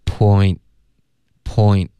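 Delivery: "point" ending on the tongue tip, t dropped; unreleased final t. Shown as a demonstration. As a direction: glide through "poi" and stop on n in "point". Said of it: "然后再顶舌尖".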